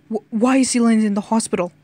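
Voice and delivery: loud, fast stammered question; alarmed demand. A narrator's reading. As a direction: demand it urgently, tripping on the first word. England demands news after slamming fist.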